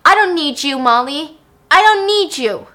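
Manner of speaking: loud, high bitter yell; screaming. Retold or imitated. Stan screaming after Molly who won't look back, angry yell. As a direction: hurl it at her back. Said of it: "he screams after her".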